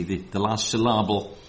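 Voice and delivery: normal talking voice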